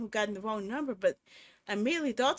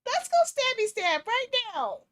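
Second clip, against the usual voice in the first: high, loud playful chirp; giddy nervous excitement; 13 semitones higher, 6 dB louder than usual. Real, not acted. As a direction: shake off fear with a joke. Nervous joking after scare.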